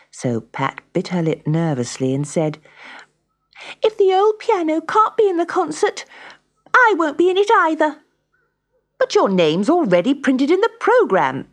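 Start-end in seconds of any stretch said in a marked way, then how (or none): 3.8-8.0 put-on high, loud child's ultimatum; defiant nervous girl. A narrator's reading. Pat refusing the concert without her piano, defiant and high. 9.0-11.5 high, loud objection; protesting teacher. A narrator's reading. Music teacher protesting Pat's withdrawal from the programme, high and loud.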